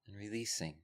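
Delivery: calm, measured voice with long pauses; guided meditation. Real, not acted. Teacher settles a group into breath and body, guided meditation.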